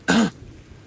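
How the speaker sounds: sharp loud throat clear from a hollow skull. Acted. Dead werewolf skull clears its throat before speaking, loud rasp. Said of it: "Winston's voice cough out".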